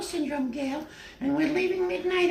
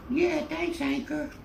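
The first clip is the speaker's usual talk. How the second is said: ordinary talk, nothing marked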